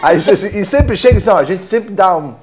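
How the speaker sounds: laughing through the story; amused, chuckling delivery; laughing at the counting trick. Real, not acted. Laughing at the anecdote.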